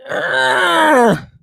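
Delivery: high anguished cry of pain. Pretend. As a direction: cry out as if stung. Paul stung by thorn, cries out.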